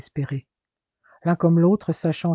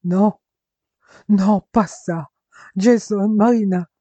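louder, higher cry of despair; anguished shout; 4 semitones higher, 6 dB louder, slower than usual. Acted. Jérémie finds his brother and Marina dead and howls in despair. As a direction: howl their names over the bodies. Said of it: "Il hurla".

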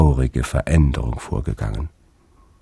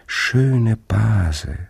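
put-on sweet childlike voice; 7 semitones higher than usual. Fabel answers the old sister.

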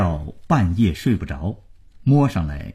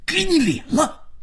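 very high, strained angry outburst; furious. Acted. Father slaps his defiant son, furious. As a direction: lash out in a high, furious burst.

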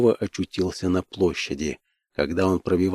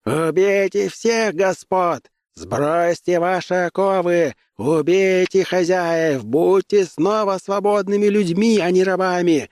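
slow, high-pitched battle cry; inciting revolt; 10 semitones higher than usual. Acted. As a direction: whip up the mob with long, high shouts. Agitator urging slaves to revolt. (протяжный призывный клич, очень высоко)

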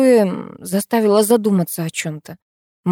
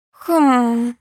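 long higher-pitched thoughtful hum; musing, drawn-out "hmm"; pondering; 5 semitones higher than usual. A narrator's reading. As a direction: hum as if weighing it up. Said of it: "задумалась Морозова".

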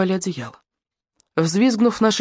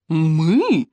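изумлённо. Acted. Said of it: "воскликнул блондин".

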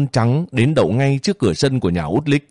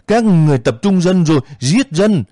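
wailing scream; 9 semitones higher than usual. Acted. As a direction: scream it out to the soldiers, half sobbing. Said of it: "Út Lích đang gào lên".

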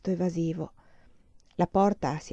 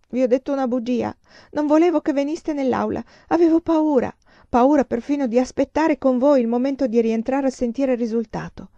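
higher, louder, agitated admission; raised voice of a distressed student; distressed confession; 6 semitones higher, 6 dB louder than usual. Acted. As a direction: confess the fear, upset and urgent. Distressed confession of lie and fear.

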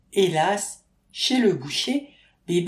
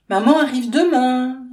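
Maxime's line; 7 semitones higher, 9 dB louder than usual. A narrator's reading. Maxime announces mother's visit.

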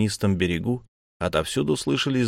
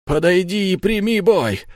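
much higher, louder furious challenge; shouting; 11 semitones higher, 4 dB louder than usual. A narrator's reading. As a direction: bellow it out. Vampire challenges the necromancer.

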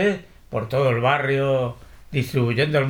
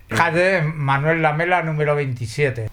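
ordinary talk

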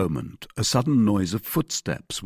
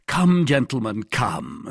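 raised, public summons; aloud; 4 semitones higher, 4 dB louder than usual. A narrator's reading. D'Artagnan turns openly to the guards, speaking aloud. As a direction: speak up so everyone hears. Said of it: "said D'Artagnan aloud".

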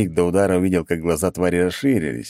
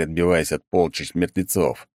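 usual speaking voice

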